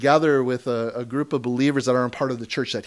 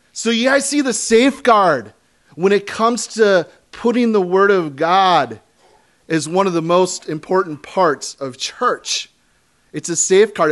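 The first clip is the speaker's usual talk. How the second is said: loud, high pulpit preaching; full-force sermon voice; fired-up preaching; 6 semitones higher, 7 dB louder than usual. Acted. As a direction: drive it home to the congregation.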